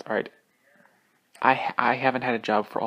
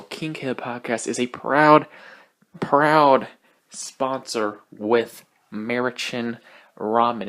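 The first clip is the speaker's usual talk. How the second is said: proud, drawn-out sponsor plug; hammed-up ad voice, slow and loud; mock ad read; 4 dB louder, much slower than usual. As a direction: sell it like a cheesy radio ad.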